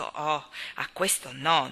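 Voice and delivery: ordinary delivery, nothing marked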